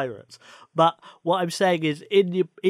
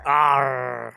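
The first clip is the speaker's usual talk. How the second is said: pirate growl; 3 semitones lower, 3 dB louder than usual. Pretend. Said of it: "echoing pirate arr".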